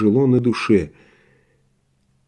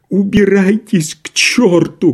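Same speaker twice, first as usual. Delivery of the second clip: high, drawn-out wailing yell; tearful shout; 8 semitones higher, 4 dB louder, slower than usual. A narrator's reading. Doctor chasing guests away, tearful shout. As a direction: cry it out as if close to tears. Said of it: "крикнул он плачущим голосом".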